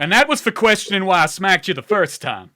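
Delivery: loud, heated reprimand; scolding. Acted. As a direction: drive the telling-off home after a second slap. Second slap, scolding continues.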